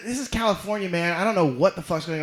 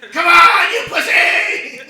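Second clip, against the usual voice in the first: much higher, much louder yell with laughter; furious shout; 12 semitones higher, 14 dB louder, slower than usual. Retold or imitated. Retelling facing down the driver with mace, a laughing furious shout. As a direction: bellow a challenge at the car. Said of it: "was like".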